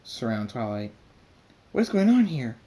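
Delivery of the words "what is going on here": high, loud demand; alarmed cry. A narrator's reading. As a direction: shout in shock as guards burst in. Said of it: "Twilight says".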